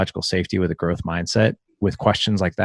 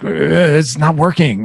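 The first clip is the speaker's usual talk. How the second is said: slow, higher, exasperated ugh; frustrated groan; 7 semitones higher, 3 dB louder, slower than usual. Shown as a demonstration. Dad acting out frustration for his son, slow and exasperated. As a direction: show a kid's pent-up frustration, drawn out. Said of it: "that feeling you get right before you get angry".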